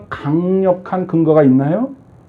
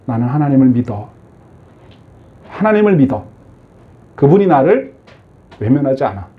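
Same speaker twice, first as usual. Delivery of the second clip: inner voice of faith; 6 semitones lower than usual. Pretend.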